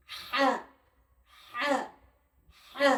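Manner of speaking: হা breathed from mid throat; careful letter drill; Arabic letter demo. Shown as a demonstration. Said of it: "হা উচ্চারণ হয় এই যে গলা".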